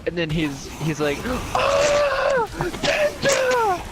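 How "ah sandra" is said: high, loud mock shriek; horrified scream. Dad seeing the zombie coworker, screaming. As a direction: scream the name in acted horror.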